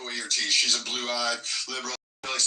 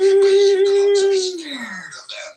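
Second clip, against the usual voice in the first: slow, much higher and louder furious shout; furious yell; 13 dB louder, slower than usual. Real, not acted. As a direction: scream it slowly at him in rage. Angry rant at opponent.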